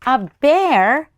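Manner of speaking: loud, rising astonished gasp-question; mock disbelief for the kids; surprised question. Reader reacts to bear tracks.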